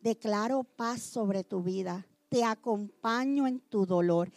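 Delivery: tierno, mucho más suave y bajo; consuelo sincero. Shown as a demonstration. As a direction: abrazar con la voz al que sufre. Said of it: "Abrace a la persona y dígale". (ejemplo de pésame al doliente, tierno y muy suave)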